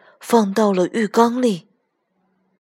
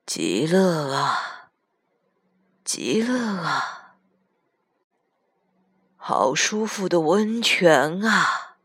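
put-on very slow, drawn-out old man's murmur; murmuring grandpa; 2 semitones lower, 3 dB quieter, much slower than usual. A narrator's reading. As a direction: murmur it in slow, soaking bliss. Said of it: "嘟哝道".

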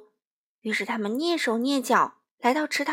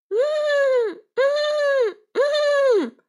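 high whinnying cries, rising to a shout; hammed-up horse neighing; animals crying out; 12 semitones higher, much slower than usual. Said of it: "一起放声大叫". (高声嘶鸣的马叫)

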